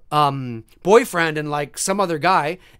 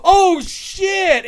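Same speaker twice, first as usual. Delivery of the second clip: loud, high hammed-up fright; big drawn-out yelp of shock; mock startled cry; 11 semitones higher, 5 dB louder than usual. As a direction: imitate a jump-scare reaction. Imitates scared kicker's reaction.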